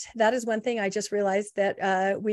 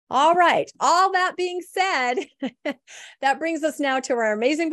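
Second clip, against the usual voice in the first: upbeat announcer; 5 semitones higher, 4 dB louder than usual. Real, not acted. Host's big transition to the guest.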